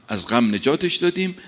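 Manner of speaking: normal talking voice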